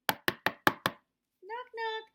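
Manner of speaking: loud knock. A narrator's reading.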